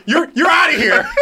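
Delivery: shouting. Pretend. Wife throwing husband out.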